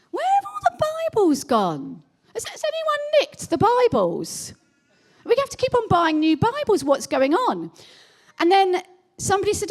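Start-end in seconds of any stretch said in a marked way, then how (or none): 0.1-7.7 high, puzzled mock exasperation; baffled church staff. Church staff puzzling over vanished Bibles, high baffled voice. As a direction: play the bewildered church leader asking where the Bibles went.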